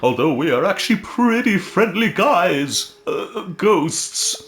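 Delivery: high, slow friendly ghost; drawn-out spooky ghost. Acted. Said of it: "That's my ghost voice".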